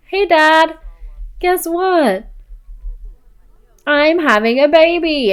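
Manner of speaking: high, teasing pretend reveal; excited announcement. Telling dad about the baby, play-acted excitement. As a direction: pitch it up to share happy news.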